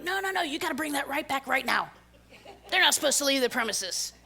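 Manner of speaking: hurried alarmed caller demanding the contract back; frantic gym staff. Retold or imitated. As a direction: rush it like a panicked clerk. Panicked gym staff on phone.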